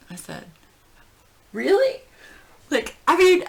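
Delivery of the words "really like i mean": high, loud starstruck blurt; giddy disbelief. Retold or imitated. Fan offered a favor by the singer, giddy. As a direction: gush like a flustered fangirl. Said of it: "I'm acting, like, so stupid".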